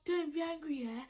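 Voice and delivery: high, small coaxing voice; imitating sheepish friend. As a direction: mimic her timid plea not to be mad. Friend nervously confessing, acted out.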